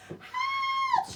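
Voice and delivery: piercing high scream for help. Acted. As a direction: shriek it in panic. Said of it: "she screamed".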